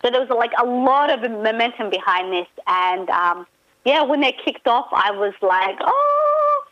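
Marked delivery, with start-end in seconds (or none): 5.8-6.6 high, drawn-out "oh" of excitement; excited gasp. Retold or imitated. Recalling to a friend how the show kicked off, excited high "oh". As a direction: squeal a long, high oh. Retold reaction as show starts.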